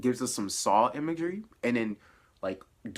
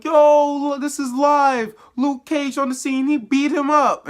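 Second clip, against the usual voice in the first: much higher and louder, a hyped-up hype man going live; hyped street reporter; 11 semitones higher, 8 dB louder than usual. Retold or imitated. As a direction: shout it like a street guy filming live on his phone. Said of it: "freaking salesman pops up".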